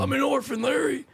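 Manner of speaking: high-pitched, hammed-up orphan complaint; mock orphan. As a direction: play a plaintive orphan, way up high. Acted bit as a complaining orphan.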